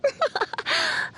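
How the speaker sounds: high, light giggle; teasing laugh. Acted. Teasing the listener.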